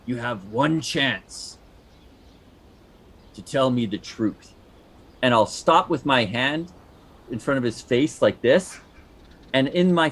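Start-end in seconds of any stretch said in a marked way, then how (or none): 0.0-4.5 menacing interrogator. Acted. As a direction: lean in and threaten slowly. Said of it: "I'll be like".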